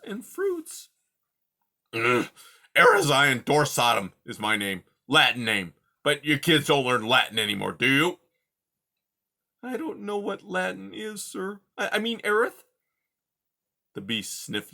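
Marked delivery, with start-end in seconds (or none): 1.9-8.2 put-on loud grumpy old creature voice; cranky old porcupine, louder; gruff old porcupine. Porcupine Aerith speaking. 9.6-12.6 tiny timid mouse voice, high; timid little mouse. Acted. Mouse Poppy speaking.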